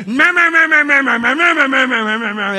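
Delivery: imitating a complaining voice on speakerphone; mock angry ranting. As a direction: parody an angry caller's babble. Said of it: "they are not happy right now".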